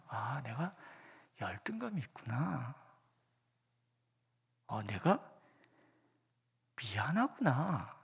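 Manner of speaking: hushed and unhurried, naming each feeling; quiet inner recognition, drawn out; soft slow self-realization. Retold or imitated. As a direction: let each realization land softly and slowly.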